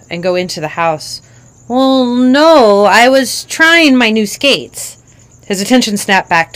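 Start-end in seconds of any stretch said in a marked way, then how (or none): 1.7-5.0 put-on higher, slow child's answer; a hesitant five-year-old; sheepish kid talking to her dad; little girl voice.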